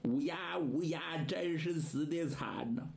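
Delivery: ordinary delivery, nothing marked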